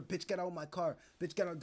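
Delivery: usual speaking voice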